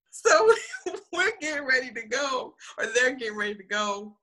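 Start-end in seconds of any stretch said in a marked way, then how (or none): none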